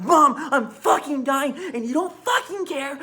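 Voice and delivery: reliving the yell at the breaking point; retold breakdown yell. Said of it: "it's my breaking point".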